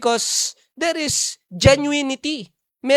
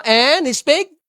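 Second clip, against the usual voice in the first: scripture reading; 4 dB louder, slower than usual. Shown as a demonstration. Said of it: "Ayan, no?".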